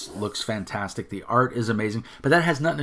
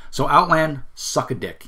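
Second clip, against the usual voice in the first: angry outburst; 5 semitones higher, 4 dB louder than usual. Real, not acted. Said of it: "sorry for the angry rant".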